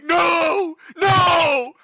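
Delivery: high desperate yelling; dramatic scream. Retold or imitated. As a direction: cry out in despair. Character reaching out, dying scream.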